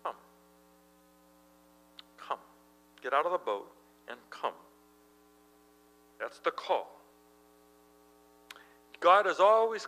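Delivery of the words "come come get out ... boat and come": much softer, hushed calling; soft invitation. Acted. Jesus urging Peter out of the boat, hushed. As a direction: beckon quietly, over and over. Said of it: "Jesus says".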